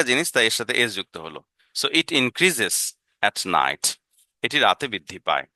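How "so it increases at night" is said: very slow, each word drawn out for learners; teacher modelling English slowly; slow modelled question. Shown as a demonstration. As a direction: spell it out slowly for the class.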